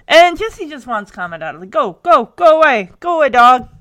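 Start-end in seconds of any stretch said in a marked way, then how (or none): 1.7-3.7 shooing shout. Retold or imitated. Jesse shooing the dog away.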